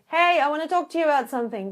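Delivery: fast, loud and abrupt; spring it on them hard; abrupt opener. Shown as a demonstration. Coach shows a harsh start to a hard talk, fast and loud. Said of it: "So instead of saying".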